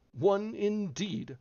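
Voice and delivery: indignant. A narrator's reading. Dormouse scoffs indignantly at Alice, slow and high. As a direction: drag the words out in offense. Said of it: "said the Dormouse indignantly".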